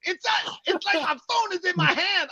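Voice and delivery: very high, whiny imitation of guilt; mock guilty pleading. Mimicking friend confessing to his wife, mock guilty pleading. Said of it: "you're like, you're like".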